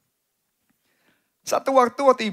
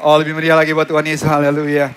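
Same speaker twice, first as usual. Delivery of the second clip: very loud, fast preaching shout; rousing shout; 13 dB louder than usual. Real, not acted. Preacher urging the congregation to cheer louder for Jesus, shouting. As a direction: whip the crowd up. Preacher urges applause for God.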